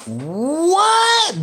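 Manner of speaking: high, drawn-out shocked "What?"; stunned, drawn-out disbelief; surprised. Real, not acted. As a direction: react in disbelief. Shocked by discovery.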